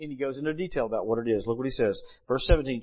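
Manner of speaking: usual speaking voice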